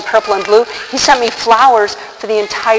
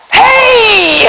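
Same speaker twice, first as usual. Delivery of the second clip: long, high holler; loud hailing yell; calling out; 12 semitones higher, 6 dB louder than usual. Retold or imitated. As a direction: shout up to someone far away. Man calls up to her.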